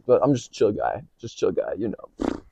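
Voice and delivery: soft, fake-casual cool guy; mock calm. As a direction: pretend to be totally chill. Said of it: "So calm".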